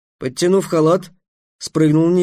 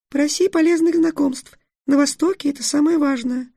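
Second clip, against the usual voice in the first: tiny fairy voice; 12 semitones higher than usual. A narrator's reading. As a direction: squeak it from under a cloak. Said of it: "тихий, придушенный голос феи". (тихий придушенный голосок феи)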